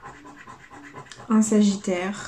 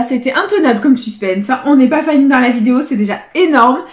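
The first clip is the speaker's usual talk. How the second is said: much louder, buoyant cry of relief; real joy bursting out after the suspense; relieved excitement; 7 dB louder than usual. Real, not acted. Telling her pals they avoided losing, loud relieved joy. Escaping a losing scratch card.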